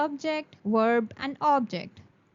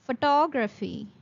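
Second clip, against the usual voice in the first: photography said pho-TOG-ra-phy; stress on TOG. Shown as a demonstration. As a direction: push the second syllable of photography. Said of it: "the word will be pronounced as".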